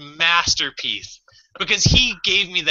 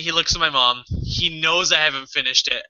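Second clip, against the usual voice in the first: usual speaking voice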